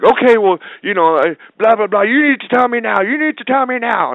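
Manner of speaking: high, loud pushy nagging; hammed-up impatient producer voice; mock pushy demand. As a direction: pester him for an answer now. Comic guy pressuring him.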